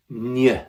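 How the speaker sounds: "ny" said slowly as one ñ sound; Spanish ñ sound. Shown as a demonstration. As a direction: draw out "ny" like the ñ in España. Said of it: "the N Y sound".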